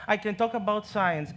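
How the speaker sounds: ordinary talk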